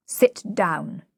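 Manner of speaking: short, firm order; peremptory. A narrator's reading. Higgins ordering the flower girl, peremptory. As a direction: command it, no argument allowed.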